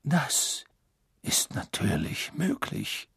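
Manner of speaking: soft polite calm. Acted. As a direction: answer courteously and softly. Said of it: "entgegnete Dumbledore höflich".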